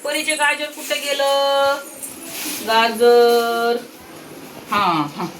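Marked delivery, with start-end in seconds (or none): 0.0-4.0 high, slow question, "गाजर" stretched long. Real, not acted. Asking the baby slowly.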